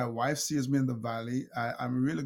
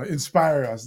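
mock challenge; 3 semitones higher, 9 dB louder than usual. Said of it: "you know, you know".

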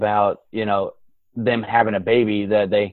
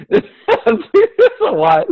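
laughing repeat; 3 dB louder than usual. Real, not acted.

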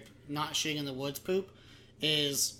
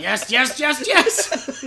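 loud, escalating mock-German shouts; hammed-up German, high and loud; extremely German; 7 semitones higher, 11 dB louder, much slower than usual. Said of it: "I think extremely German".

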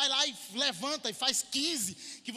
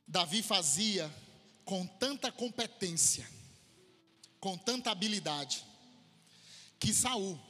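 scripture reading; 6 semitones lower than usual. Acted. Reads scripture passage. (leitura bíblica lenta e solene)

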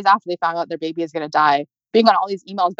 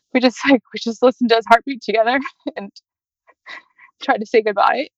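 holding back tears; 5 semitones higher than usual. Real, not acted. Saying goodbye to baby.

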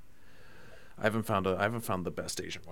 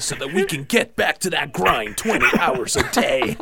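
loud, higher mock hype-man voice; The Rock; 5 semitones higher, 10 dB louder than usual. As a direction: belt it out like a motivational slogan. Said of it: "Quote The Rock Johnson".

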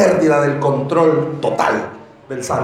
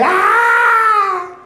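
sudden yell. Retold or imitated. Preacher demonstrates a shout while explaining yelling. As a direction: let out a sharp yell to show the shouting.

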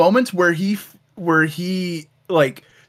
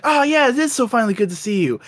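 higher, gleeful hammy villain; lightly voiced evil brother gloating; mock cartoon villain; 7 semitones higher than usual. The evil brother betraying Bromley, gleeful and hammy. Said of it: "his brother goes like".

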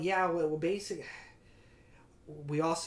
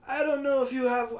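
badly faked southern accent, pushed up high; Al Pacino, southern accent; 12 semitones higher, 8 dB louder than usual. Podcaster attempts the boss's voice, bad southern accent. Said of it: "trying to do Al Pacino doing a southern accent".

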